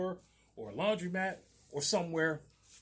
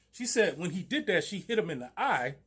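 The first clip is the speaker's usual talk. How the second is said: ordinary talk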